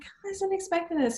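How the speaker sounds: high-pitched surprised client, imitated; pleasantly surprised. Client reacting to an unexpected gift, pleasantly surprised. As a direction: mimic a client caught off guard, pitched high.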